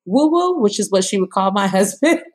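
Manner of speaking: high, commanding doctor voice; imitating the doctor barking orders; doctor giving orders. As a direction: direct the family like a drill sergeant. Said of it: "she directed my mom and she was like".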